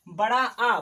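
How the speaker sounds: बड़ा आ with the आ pushed out loud; loud strong long आ; forceful long aa. Shown as a demonstration. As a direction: punch the आ in बड़ा आ. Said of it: "बोलने पे जोर से आ".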